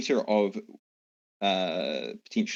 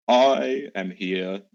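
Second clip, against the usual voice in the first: slow, louder booming giant voice; a giant lazily announcing himself; storm giant; 8 dB louder, much slower than usual. Acted. Storm giant greets the scolding mayor, slow booming giant voice. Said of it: "the storm giant just kind of laughs and says".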